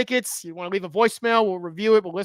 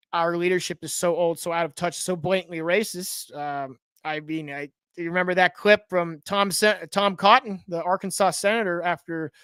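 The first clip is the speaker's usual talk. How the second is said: normal talking voice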